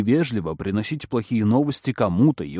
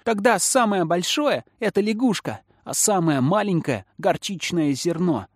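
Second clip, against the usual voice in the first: put-on high-pitched kid cutting in; another child's voice; 6 semitones higher than usual. A narrator's reading. Second child cutting into the merry chatter, high childish voice. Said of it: "прорезался голос другого ребёнка сквозь весёлый шум".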